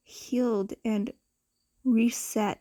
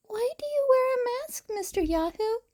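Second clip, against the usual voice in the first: put-on high, quick child asking; a small girl's eager question; curious little girl; 12 semitones higher, faster than usual. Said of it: "Eri asked one day".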